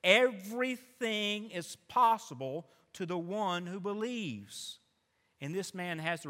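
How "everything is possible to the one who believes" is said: slow, weighty pronouncement; Jesus declaring the promise slowly and solemnly; solemn declaration. Acted. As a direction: let each word land slowly. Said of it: "here's what the Lord says".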